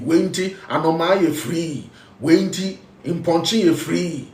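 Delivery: usual speaking voice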